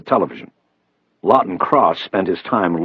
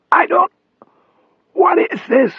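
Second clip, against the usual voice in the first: thin, whispery strained rasp; raspy voice; 4 dB louder than usual. A narrator's reading. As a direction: rasp it out, struggling for voice. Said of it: "Cross rasped".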